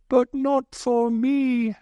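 high, slow, self-pitying moan; mock self-pity. Worrier's voiced complaint.

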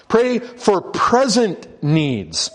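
very slow, each word weighed; preacher landing the point; slow emphatic. Shown as a demonstration. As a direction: drive it home slowly. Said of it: "Put the emphasis there on daily".

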